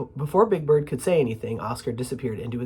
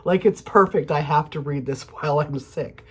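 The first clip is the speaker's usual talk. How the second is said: plain reading voice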